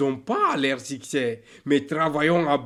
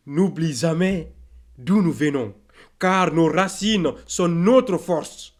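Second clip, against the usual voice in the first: raised, forceful declamation; emphatic exhortation; 2 semitones higher, 4 dB louder than usual. Acted. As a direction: drive the reminder home firmly and loudly. Emphatic repeat of the line.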